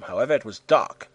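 ordinary delivery, nothing marked

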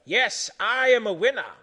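high, loud victory cry; happy triumph. A narrator's reading. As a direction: crow it with loud delight. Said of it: "say happy Centipede".

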